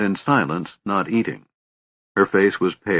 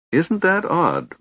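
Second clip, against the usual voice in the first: bright cheerful question, much higher and slow; 10 semitones higher, slower than usual. A narrator's reading. Kender chiming in oblivious, cheerful. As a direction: chirp it innocently. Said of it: "remarked the Kender cheerfully".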